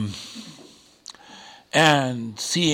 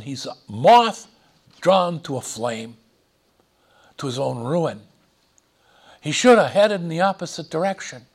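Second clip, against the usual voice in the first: normal talking voice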